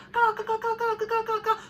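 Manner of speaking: high-pitched imitated crow caws; relentless high crow squawking; cawing crows. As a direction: caw over and over like a flock. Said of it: "just cawing nonstop".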